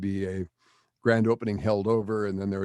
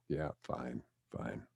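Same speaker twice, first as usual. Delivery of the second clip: much softer, lower, worn-out give-in; eye-rolling surrender; 7 semitones lower, 13 dB quieter, slower than usual. Retold or imitated. Federated president giving in to his ad man, weary eye-roll. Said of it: "he rolled his eyes and said".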